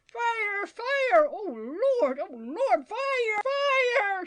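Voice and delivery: high, wailing fire alarm; old woman crying out in terror; panicked shout. A narrator's reading. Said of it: "shouted the old woman".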